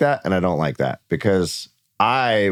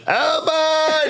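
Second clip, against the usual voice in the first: put-on much higher, screamed Oh boy; screamed old boy. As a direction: scream it with a twang spin. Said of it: "put that spin on it and screaming it".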